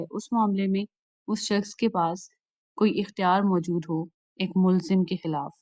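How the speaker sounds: normal talking voice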